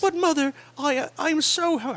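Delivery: put-on high-pitched Cockney kid pleading with mother; put-on high Cockney child voice. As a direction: whine it as a little Cockney urchin. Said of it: "got a Cockney accent".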